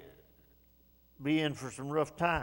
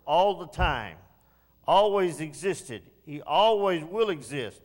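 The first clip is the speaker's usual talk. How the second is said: louder, driving preaching on God's presence; 3 semitones higher, 6 dB louder than usual. Acted. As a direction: push it out to the pews with conviction. Preaching surges again.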